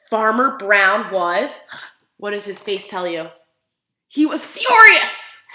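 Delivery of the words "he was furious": higher, much louder, slowed; emphatic furious. A narrator's reading. Teacher acting out the farmer's fury to children. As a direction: make the word furious land big. Showing farmer's anger to kids.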